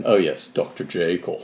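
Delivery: put-on stagey old movie actor; lightly voiced old Hollywood star. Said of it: "You're speaking like old Hollywood".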